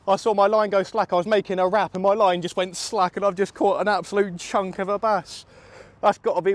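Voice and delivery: can't believe the size of it; real excitement. Real, not acted. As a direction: talk fast and thrilled after the catch. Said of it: "I'm shaking".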